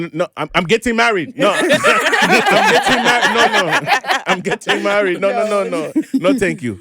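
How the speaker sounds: high, laughing no thank you; laughing refusal. Retold or imitated. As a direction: refuse through giggles. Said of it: "I said".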